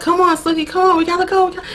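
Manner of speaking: high-pitched, hurried acted urging; urgent coaxing. Lady telling Sookie they gotta go, urgent. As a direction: hurry her along in a high voice.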